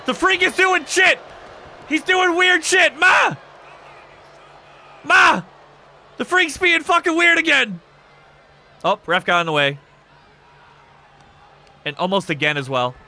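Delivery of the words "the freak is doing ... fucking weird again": high, loud hammed-up shouting; mock yell for mum. Streamer jokingly yells to Ma about a weird player, hammed-up shout. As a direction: call out to Ma like a scared kid.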